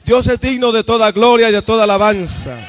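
fuerte y alto, predicando con fervor; predicación a toda voz. Real, not acted. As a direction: proclama con fuerza a la congregación. (predicando fuerte y exaltado)

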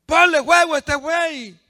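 high, loud demand; acted desperate shouting; another prophet's shouted plea. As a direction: bellow it up to a silent god. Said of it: "le decía otro".

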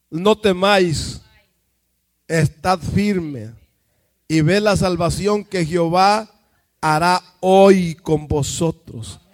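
lento y fuerte, proclamando la Escritura; lectura solemne. Real, not acted. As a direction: declama cada palabra con peso. (proclamando a Moisés lento y solemne)

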